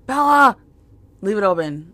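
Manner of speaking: loud, high call across the house. Retold or imitated. Mom calling daughter back from the door, loud high call. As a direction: call her name so she comes running.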